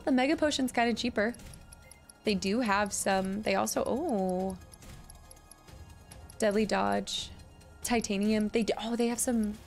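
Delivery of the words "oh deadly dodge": ordinary talk, nothing marked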